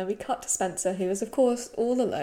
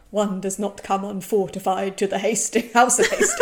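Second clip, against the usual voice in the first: pompous proclamation, building louder into laughter; mock medieval knight; 6 dB louder than usual. Drunk Brit announces himself at the Hastings house, mock-grand. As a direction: declare it grandly, cracking up. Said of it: "He turns into a medieval knight".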